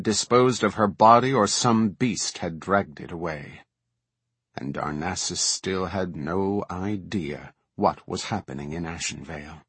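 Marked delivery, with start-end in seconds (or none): none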